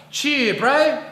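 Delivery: lightly imitated New Zealand drawl; broad kiwi accent. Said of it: "is not a sentence".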